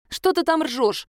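a touch of affronted protest; indignant. A narrator's reading. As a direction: pipe up in mild indignation. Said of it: "возмутилась я".

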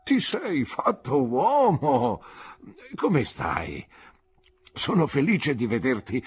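put-on an elderly teacher overcome with joy, voice climbing; kindly old doctor. Old doctor welcoming grown-up pupil, overjoyed and voice breaking.

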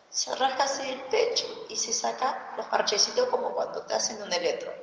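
ordinary delivery

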